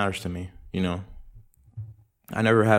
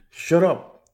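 high, loud play-angry shout; mock yell; 9 semitones higher, 6 dB louder than usual. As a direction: bark it up high to shut them up. Playfully snapping at someone nearby.

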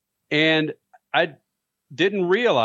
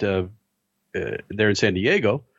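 usual speaking voice

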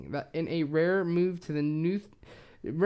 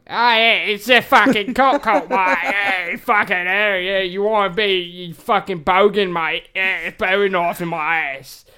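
loud, hammed-up Aussie accent; Australian accent; 3 semitones higher, 10 dB louder than usual. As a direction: bellow it as a mock Australian bogan. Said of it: "they said it in Australian language".

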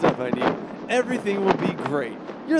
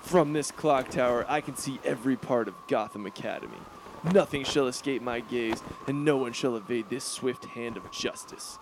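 lower, grave superhero vow; dark ominous declaration; 4 semitones lower, 4 dB quieter than usual. Acted. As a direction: brood like a vigilante swearing vengeance from a tower. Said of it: "my dark and ominous declarations".

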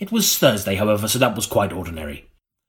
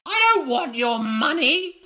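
put-on much higher screeching old woman; screeching crone. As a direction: screech it like a witch. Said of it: "she screeched".

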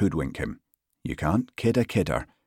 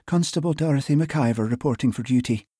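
higher-pitched Hebridean woman's voice; soft Western Isles lilt; 7 semitones higher than usual. A narrator's reading. New constable reporting to her sergeant, soft Western Isles lilt. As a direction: report in with a lilting island accent. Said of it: "in a soft voice delicately laced with an endearing lilt".

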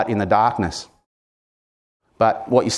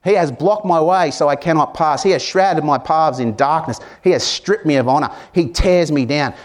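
lifted, higher reading of Job's words; scripture reading; 4 semitones higher than usual. Acted. Pastor reading Job's plea to the congregation, higher and earnest. Reads Job's lament aloud.

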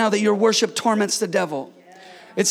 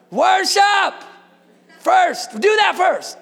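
preacher's shout; 7 semitones higher, slower than usual. Real, not acted. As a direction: drive the answer home. Pastor's shouted prescription.

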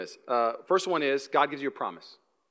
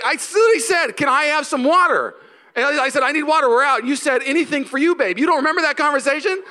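much higher and louder indignant rant; mock exasperation; 10 semitones higher, 5 dB louder than usual. Husband scolding wife for forgetting water, high indignant rant. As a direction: rant like a wronged husband, fast at the end. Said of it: "And I was like".

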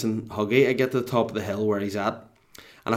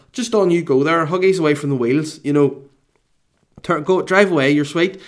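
higher, louder mock-cheery send-off; acting out an encouraging wave-on, pitched up; polite urging; 6 semitones higher, 6 dB louder than usual. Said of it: "in my head, I'm trying to say".

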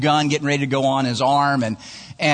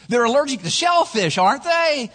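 put-on high-pitched babysitter tag question; clueless checking; 10 semitones higher than usual. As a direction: ask it high and breezily unsure.

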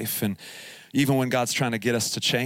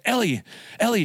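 pitched-up urgent call of her name; 9 semitones higher than usual. Retold or imitated. Dad in the passenger seat calling his daughter urgently. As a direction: snap her attention back before the turn.

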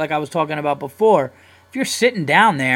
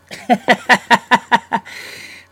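high loud laugh; 9 semitones higher, 8 dB louder than usual. Real, not acted. Podcaster cracking up at his own bit, high laugh.

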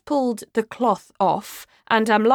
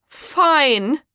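raised, high-pitched retort; louder; 7 semitones higher, 5 dB louder than usual. Acted. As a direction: snap it back loudly, higher. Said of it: "I say louder".

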